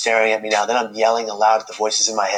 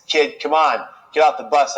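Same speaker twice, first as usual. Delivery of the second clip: a higher, louder mock call from the driver; retold bus driver's call; 5 semitones higher, 4 dB louder than usual. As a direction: call out to the kid, hammed up. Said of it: "He looked at me and he said".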